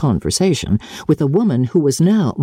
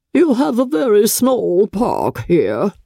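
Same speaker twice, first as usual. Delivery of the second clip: higher, slow, disdainful; haughty lady; 8 semitones higher, slower than usual. A narrator's reading. Noble guest belittles the mother's garden, slow and disdainful. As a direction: drawl it as a put-down.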